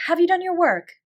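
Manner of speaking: different tone. A narrator's reading. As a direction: switch to practical concern. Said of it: "she spoke in a different tone".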